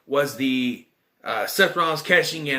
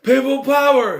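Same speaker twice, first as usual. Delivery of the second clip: high, loud slogan shout; mock rallying cry; 8 semitones higher, 6 dB louder than usual. John Laurinaitis riding in on a scooter, loud mock rallying cry. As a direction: bellow it like a campaign chant.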